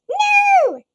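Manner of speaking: high, loud, shouted no; big shouted no. A narrator's reading. Reading the monsters' tantrum word, shouted. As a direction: belt it like a tantrum.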